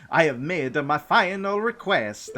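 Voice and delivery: high, slow, lilting mock-Swedish accent; almost Swedish accent. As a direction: put on a Scandinavian-sounding accent, drawn out. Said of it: "it sounds almost Swedish".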